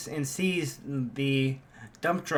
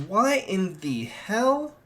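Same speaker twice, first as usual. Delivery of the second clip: shocked; 6 semitones higher, much slower than usual. Acted. Izuku sees his leg is a blade.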